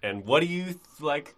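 ordinary delivery

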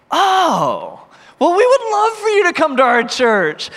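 high, loud burst of enthusiasm; excited welcome. Retold or imitated. Pastor welcoming two girls to his church, high and excited. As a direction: burst out high with an eager invitation. Delighted invitation after awkward question.

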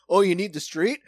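loud mock caveman jerk; troglodyte. Imagines a rude neighbor parking on the sidewalk, loud sneer. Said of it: "that troglodyte that's like".

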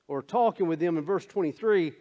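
ordinary talk, nothing marked

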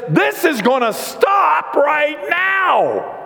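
high, drawn-out shouted order; angry command. Acted. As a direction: thunder it slowly, putting a halt to it. Jesus acted flipping the money tables.

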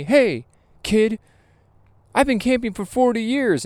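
high, cocky put-on voice; mock old camper. Host quoting the veteran camper, high and smug. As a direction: play the know-it-all camper. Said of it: "the guy says to me".

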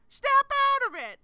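high, loud mock [name] shout; [name] impression. Fan showing her only [name] bit, loud yell. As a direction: belt the line like [name]. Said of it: "All I got is".

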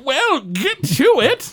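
high, slow bossy goblin voice; megalomaniac rant. Acted. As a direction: command them grandly. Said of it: "He goes".

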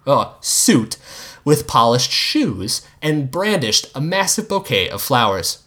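ordinary delivery, nothing marked

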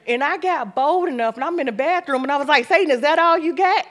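bold challenge. Retold or imitated. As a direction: square up to the devil.

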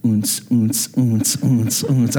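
low, steady nightclub thump; beatbox a toy's pulsing dance beat; imitated drum beat. Father imitating the toy cat's drum beats, low thumping noise. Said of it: "all of a sudden we hear".

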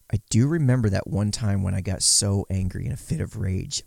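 usual speaking voice

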